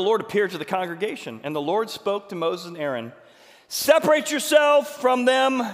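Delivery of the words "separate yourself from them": loud, higher, slow command. Acted. God ordering Moses and Aaron, slow loud command. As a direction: command it with divine authority.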